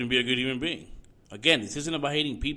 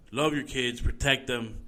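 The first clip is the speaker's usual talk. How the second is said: ordinary talk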